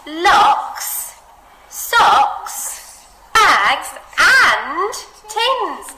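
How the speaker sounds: and stretched long, high and loud; listing with a huge drawn-out and; expressive list. Shown as a demonstration. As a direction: draw out and to show the mess. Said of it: "really adding expression to the word and".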